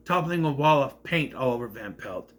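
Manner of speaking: usual speaking voice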